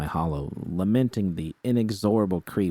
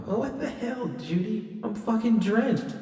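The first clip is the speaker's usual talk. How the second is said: much higher, slow indignant whine. Acted. V drenched by Judy, high and indignant. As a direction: complain about being soaked.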